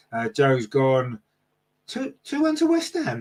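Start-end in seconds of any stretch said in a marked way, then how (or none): 1.9-3.2 high-pitched, disbelieving echo of the score; incredulous. Real, not acted. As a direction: repeat it as if you can't believe it. Reacts to chat member's prediction.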